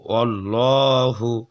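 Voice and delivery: "ওয়াল্লাহু" slow, with a dark rounded L; full heavy lam. Shown as a demonstration. Teacher modelling Allah's name, "ওয়াল্লাহু" with full lam. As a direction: thicken and hold the "ল্লা" in "ওয়াল্লাহু". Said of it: "লামটাকে পুর করবো".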